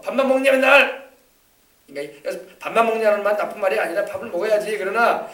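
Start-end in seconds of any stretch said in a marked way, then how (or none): 0.0-1.1 high, loud scolding question; fed-up parent yelling. Retold or imitated. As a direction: nag the child loudly. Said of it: "그러면서".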